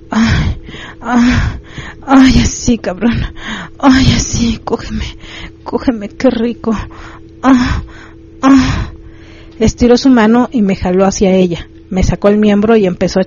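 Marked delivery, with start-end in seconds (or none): 0.1-8.9 higher-pitched acted moans of pleasure; moaning with pleasure. A narrator's reading. Narrator voicing the woman in his story, moaning with pleasure. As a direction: voice her pleasure in drawn-out moans. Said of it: "gemir de placer".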